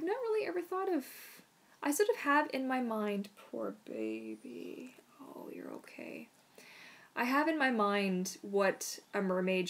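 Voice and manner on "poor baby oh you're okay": much lower, slow, soft murmur; tender murmur; soft comforting aside. Real, not acted. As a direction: soothe the dog mid-sentence. Aside to scared dog.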